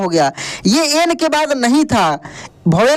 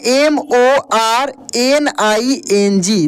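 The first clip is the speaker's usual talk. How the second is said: letter by letter, slow and clear; slow, deliberate spelling of each letter; much slower than usual. Shown as a demonstration. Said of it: "spelling out letter by letter".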